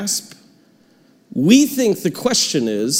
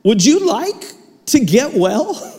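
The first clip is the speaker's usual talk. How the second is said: blunt question; 8 semitones higher, 3 dB louder, slower than usual. Retold or imitated. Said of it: "And Jesus walks up and goes".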